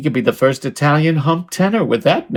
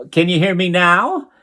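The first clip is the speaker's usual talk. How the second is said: ordinary delivery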